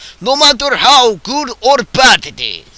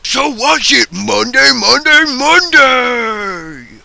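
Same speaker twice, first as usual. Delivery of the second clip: promo hype; 4 semitones higher than usual. Acted. Monster truck promo cliché.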